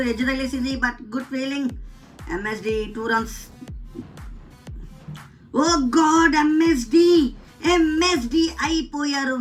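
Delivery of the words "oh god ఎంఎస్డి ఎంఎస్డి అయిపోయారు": shocked groan. Real, not acted. Wicket falls in gaming commentary.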